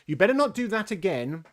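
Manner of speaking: high, loud reprimand; shouted warning. A narrator's reading. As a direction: shout it out at the culprits. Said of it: "and shouted out".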